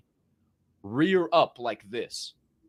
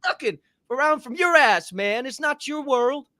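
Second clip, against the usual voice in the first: high-pitched comic scolding; exasperated rant; 10 semitones higher than usual. Pretend. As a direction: keep scolding in a raised pitch. Rant continues after interjection.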